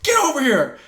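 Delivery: high, loud imitation of a yelling referee; retold shouted order. Referee calling the fighter over, shouting. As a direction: holler a summons at someone.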